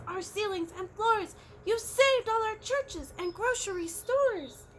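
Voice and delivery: mayor of the speck, grateful; tiny Who voice. A narrator's reading. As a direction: speak as a tiny grateful person. Voice on the dust speck.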